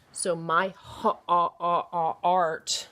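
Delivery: "ha-, ah, ah, art" drawn out bit by bit; sounding out. Shown as a demonstration. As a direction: stretch the "ah" in "art" slowly. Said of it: "there it ... sounds like an A R".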